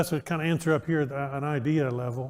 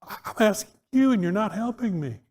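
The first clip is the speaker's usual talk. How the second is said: mock exasperation; 5 semitones higher than usual. Real, not acted. Teacher pleading with silent class.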